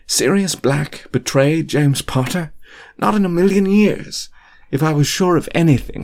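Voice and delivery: frantic, desperate to be believed; lightly voiced frantic friend; agitated Remus. A narrator's reading.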